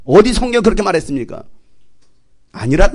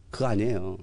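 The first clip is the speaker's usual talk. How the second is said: ordinary talk, nothing marked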